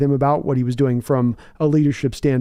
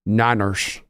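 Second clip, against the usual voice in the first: Liam Neeson impression; 5 semitones lower than usual. Streamer showing chat a one-word Liam Neeson impression. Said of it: "Here's my Liam Neeson for you guys".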